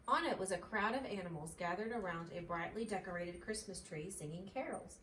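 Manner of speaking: plain reading voice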